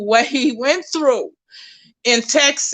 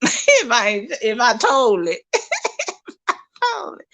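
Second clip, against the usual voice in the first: talking through a laugh; amused, laugh breaking into the words. Real, not acted. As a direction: giggle while getting it out. Laughing at own joke.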